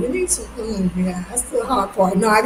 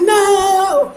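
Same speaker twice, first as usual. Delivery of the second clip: high, loud acted refusal; exasperated cry; 9 semitones higher, 5 dB louder than usual. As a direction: throw out a dramatic, raised-voice refusal. Acts out her refusal to Trent.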